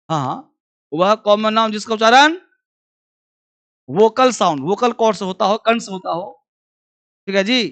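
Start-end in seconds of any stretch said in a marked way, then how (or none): none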